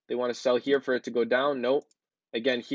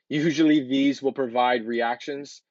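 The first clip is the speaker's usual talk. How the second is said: slow, deliberate "Usually these" after a stumble; careful restart; 3 dB louder, slower than usual. Real, not acted. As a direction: say "Usually these" again slowly and clearly, word by word. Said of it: "I can't even speak".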